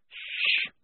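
unvoiced shush; a long whispered "shh"; hushing. A narrator's reading. Polly hushing Maledict as Shufti sleeps, hushing.

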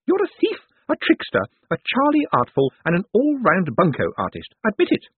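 put-on high, punchy old woman's accusation; triumphant old lady. A narrator's reading. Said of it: "went on triumphantly".

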